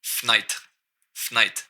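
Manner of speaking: fenêtre clipped to "fnaïtre"; Québécois fenêtre with e swallowed; skipped syllable. Shown as a demonstration. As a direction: drop the first e of fenêtre, starting on "fn". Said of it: "j'ai skippé une syllabe ici".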